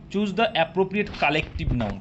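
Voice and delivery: very slow, word by word; slow dictation read. Shown as a demonstration. Teacher dictating the question to students, slow and deliberate. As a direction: read the question out slowly for the class.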